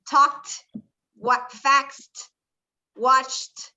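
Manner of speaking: "Talked, faxed, watched" each one syllable ending in a crisp T; tokt, fakst, watcht for "Talked, faxed, watched". Shown as a demonstration. Teacher models for class, "Talked, faxed, watched" all closing on T. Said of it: "Todas acaban con esa T".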